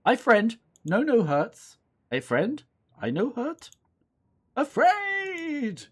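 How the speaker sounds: high-pitched pleading creature voice; frightened talking possum. Acted. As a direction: plead in broken English like a scared critter. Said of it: "the possum can talk".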